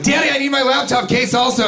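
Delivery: put-on high whiny little-girl voice; mock childish pleading; whiny daughter. Comic mimicking the daughter begging her father. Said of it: "She's like".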